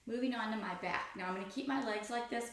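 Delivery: ordinary talk, nothing marked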